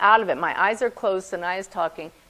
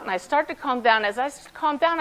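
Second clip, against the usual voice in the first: usual speaking voice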